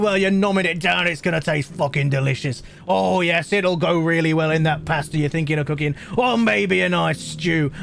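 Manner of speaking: cheeky British shopkeeper patter; British accent. Pretend. Streamer playing a British merchant warning about peppers, in a light British accent. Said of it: "British voices".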